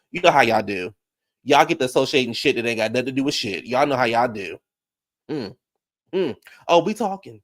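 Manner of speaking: usual speaking voice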